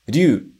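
"ryu" as one sound, ryu-u; teacher models "ryu" in one glide; contracted ryuu. Shown as a demonstration. Teacher showing dragon word: "ryu" as one contracted sound. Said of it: "o segundo que é o dragão".

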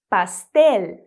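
pastel with a clear L at the end; pastel, final L fully pronounced; Spanish pastel. Shown as a demonstration. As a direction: say pastel with the tongue tip L, not a U. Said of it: "Presta atenção no L mais exagerado".

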